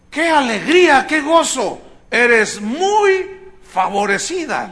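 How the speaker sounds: high, loud, slow joyful greeting; the angel greets her with overflowing joy. Acted. Preacher voicing the angel to Mary, joyful and loud.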